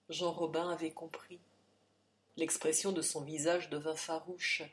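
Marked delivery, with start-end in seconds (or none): none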